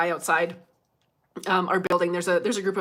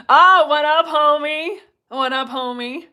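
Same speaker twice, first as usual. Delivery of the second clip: high, loud street-style hello; mock homie greeting; 7 semitones higher, 10 dB louder, slower than usual. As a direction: play cool buddy greeting. Greeting a viewer.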